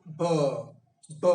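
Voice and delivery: "b" twice, lips pressed then released; voiced "b" twice; repeated b sound. Shown as a demonstration. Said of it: "releasing the air".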